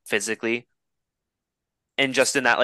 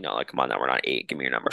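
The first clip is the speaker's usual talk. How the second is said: much lower, slightly hushed retold protest; retold firm pushback; 10 semitones lower, 4 dB quieter, faster than usual. Man retelling how he asked for her number, low pushback. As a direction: drop low and mock-seriously insist.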